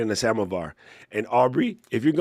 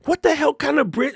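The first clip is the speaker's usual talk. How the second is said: incredulous outburst; 4 dB louder than usual. Real, not acted. Mock outrage at the tea answer.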